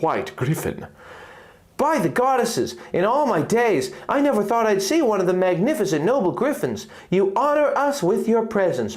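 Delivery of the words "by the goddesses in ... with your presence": high, awed royal voice; reverent princess. Acted. Princess Zelda greeting the noble griffin, awed. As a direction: speak with wonder and honor.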